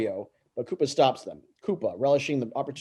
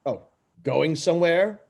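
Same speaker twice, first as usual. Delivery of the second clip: menacing villain; 3 semitones higher than usual. Acted. Koopa's booming villain voice.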